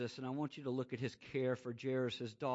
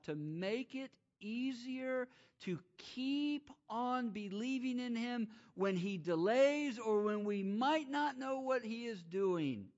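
higher, slower preaching; higher impassioned preaching; preaching build; 10 semitones higher, 3 dB louder, slower than usual. Acted. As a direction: lift and stretch the phrase. Preacher rising in pitch.

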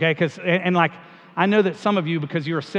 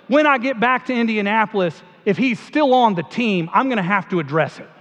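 put-on higher, louder aw-shucks Southern accent; folksy Southern accent, pitched up and loud; aw shucks Southern Peyton Manning; 4 semitones higher, 4 dB louder than usual. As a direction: play the humble Southern quarterback in a Southern accent. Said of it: "sort of talks like this".

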